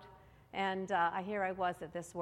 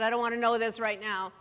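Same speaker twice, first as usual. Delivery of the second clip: louder, mildly angry retort; retold anger; 7 dB louder than usual. Wife snapping back at her husband's advice, mock angry. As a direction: act out an annoyed refusal, louder. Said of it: "I was angry".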